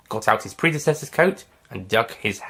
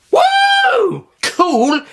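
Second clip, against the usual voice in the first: very high, loud joyful whoop; excited whoop; 8 dB louder than usual. Acted. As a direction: cheer the thrill of it. Doctor thrilled after explosion.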